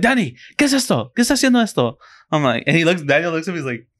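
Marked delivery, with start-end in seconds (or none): 0.0-2.0 high-pitched imitation of mom calling out a brother; questioning mother. Son imitating mom showing pictures to his brother, demanding. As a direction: mimic mom's Spanish interrogation, way up high. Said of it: "She's like".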